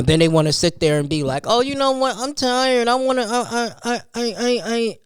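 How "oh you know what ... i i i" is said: put-on whiny kid. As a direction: play the kid stalling at bedtime. Mimicking kid at bedtime.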